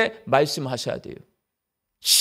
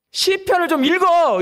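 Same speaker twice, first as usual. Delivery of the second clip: higher, firmer mock command from God; a lightly voiced God, pitched up with insistence; God telling you to read; 6 semitones higher than usual. Preacher voicing God urging the praying believer, a pitched-up command. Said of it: "이렇게 말씀하실 거란 말이에요".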